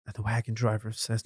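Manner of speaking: plain reading voice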